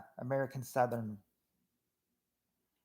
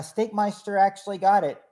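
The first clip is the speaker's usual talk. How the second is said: higher and louder, thrilled reveal; excited announcement; 6 semitones higher, 8 dB louder than usual. Real, not acted. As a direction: announce the winner with real delight. Host reveals a viewer's correct answer.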